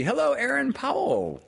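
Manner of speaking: very high, sung-out hello; squealed friendly hello; excited greeting. Real, not acted. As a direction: greet with delighted high pitch. Greeting viewer in chat.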